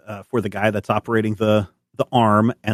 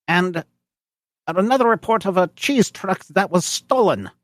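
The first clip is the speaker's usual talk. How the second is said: officious German officer; 12 semitones higher than usual. Acted. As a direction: report the alerts like a pompous checkpoint officer. GM voices booth officer.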